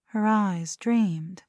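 plain reading voice

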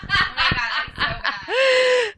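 giggling high-pitched; laughing. Real, not acted. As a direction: crack up and apologize. Breaks up laughing before reading.

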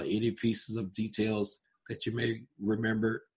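ordinary talk, nothing marked

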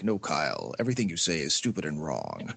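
deep gravelly mock-serious put-on voice; low growling put-on voice; gruff low voice. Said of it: "I could have been like".

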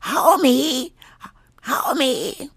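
high, strained cartoon wife voice; high raspy cartoon housewife impression; Marge Simpson. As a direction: call plaintively in a high cracked rasp. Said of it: "Marge Simpson voice".

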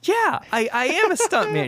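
proud stuntman. Retold or imitated. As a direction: blurt it out, thrilled to be recognised. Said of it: "He's like".

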